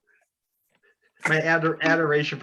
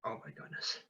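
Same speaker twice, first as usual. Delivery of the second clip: breathless, whispered awe; awed whisper; 3 semitones lower, 12 dB quieter than usual. Acted. Starstruck fan learning the captain will speak, whispered awe.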